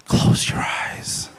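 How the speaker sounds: slow, voiceless, sinister whisper; creepy whisper. Retold or imitated. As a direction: whisper it like a horror villain. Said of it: "Just whisper".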